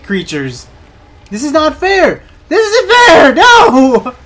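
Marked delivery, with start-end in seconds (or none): 1.3-4.2 high, loud wail of protest; outraged shout. Real, not acted. Gamer losing right at the end, high loud protest. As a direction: cry out at the unfairness.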